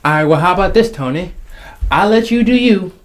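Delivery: ordinary talk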